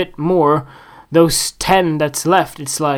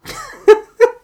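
very high squeal of laughter; squeaky laugh; 6 dB louder than usual. Real, not acted. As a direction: squeal at your own nonsense. Laughs after silliness.

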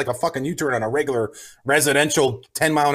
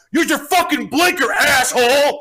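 a much higher, louder shouted insult; retold angry yell; 9 semitones higher, 3 dB louder, slower than usual. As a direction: bark it like an angry driver. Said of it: "This guy yells out".

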